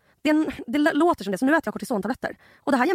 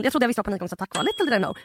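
normal talking voice

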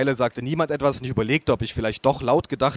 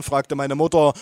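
ordinary delivery

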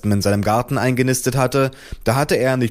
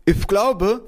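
high, muffled mother with lisping consonants; whispering mother; 11 semitones higher than usual. A narrator's reading. Mother reporting a hornets' nest, high muffled whisper-role. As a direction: speak high through a swollen mouth. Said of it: "flüsterte sie".

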